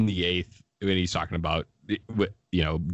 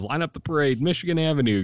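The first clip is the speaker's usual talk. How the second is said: celebratory call; 8 semitones higher, faster than usual. Retold or imitated. Imitating the announcer after the final out, higher mock celebration. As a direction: proclaim it like a parade is coming. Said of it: "old Chicago themed speech".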